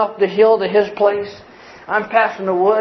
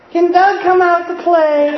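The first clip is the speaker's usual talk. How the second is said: mock innocent kids; 9 semitones higher, 3 dB louder, slower than usual. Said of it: "they said".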